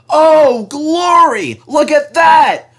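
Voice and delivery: very high, loud cry of alarm; alarmed shout. A narrator's reading. The driver spots a train on their line, shouting high. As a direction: shout at the danger.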